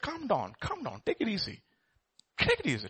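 soft, hushed calming words; soothing. Retold or imitated. Pastor calming the armed drunk man, soft and soothing. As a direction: settle him down gently.

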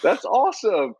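mock gushing praise from a customer; impressed lady. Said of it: "and she said".

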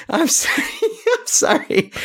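giggling through an apology; can't stop laughing. Real, not acted. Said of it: "I apologize for my laughter there".

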